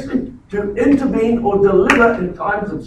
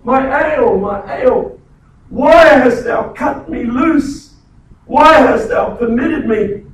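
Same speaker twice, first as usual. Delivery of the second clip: loud, slow, higher pleading shout; anguished cry; 4 semitones higher, 6 dB louder than usual. Retold or imitated. Preacher acting Jesus crying from the cross, loud and slow. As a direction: cry out to heaven for rescue. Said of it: "He's yelling out for intervention".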